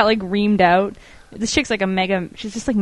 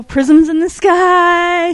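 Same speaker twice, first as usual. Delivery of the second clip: higher, louder, very slow mock awe; mock wonder; 7 semitones higher, 5 dB louder, much slower than usual. Friend mocks science explanations, slow loud mock awe.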